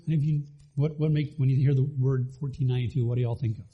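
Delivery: ordinary delivery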